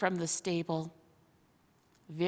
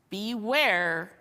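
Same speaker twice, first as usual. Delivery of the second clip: plain reading voice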